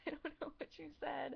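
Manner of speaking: high, lost and sheepish; bewildered reply to a joke she couldn't follow; confused. Retold or imitated. Telling Irish men she didn't catch their joke, bewildered. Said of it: "I would be like".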